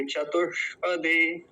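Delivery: चतुष्पदे slowly with the ष held; careful चतुष्पदे, half ष. Shown as a demonstration. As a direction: linger on the retroflex sibilant in चतुष्पदे. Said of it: "ध्यान से बोलेंगे". (चतुष्पदे में आधे ष का सावधान उच्चारण)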